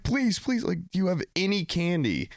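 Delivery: high, pleading whine; high begging. Retold or imitated. Man begging partygoers for candy, high pleading whine. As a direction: beg them for candy. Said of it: "I'm like".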